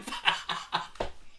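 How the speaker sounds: breathy, voiceless burst of laughter; real laugh. Real, not acted. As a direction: crack up at your own taunt. Laughs at the joke.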